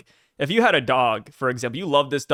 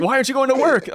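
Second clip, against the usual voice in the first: wife's question; 9 semitones higher, 3 dB louder, faster than usual. Retold or imitated. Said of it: "wife asking".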